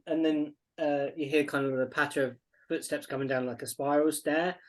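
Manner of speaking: normal talking voice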